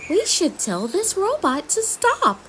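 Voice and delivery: high fairy queen voice; Queen Thistle. Acted. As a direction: say it worried as a queen. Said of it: "says Queen Thistle".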